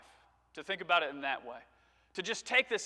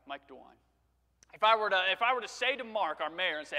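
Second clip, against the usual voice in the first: ordinary talk, nothing marked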